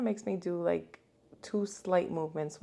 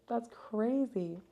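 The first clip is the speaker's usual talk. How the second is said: higher, long drawn-out exclamation; stunned disbelief; 4 semitones higher, much slower than usual. Real, not acted. Patient repeating her verdict on the fee, stunned. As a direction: stretch it out, appalled at the price.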